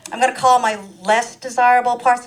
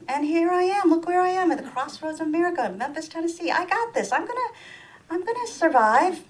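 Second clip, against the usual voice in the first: much higher self-pep talk, rallying; mock pep talk; 9 semitones higher than usual. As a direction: cheer yourself on, comically.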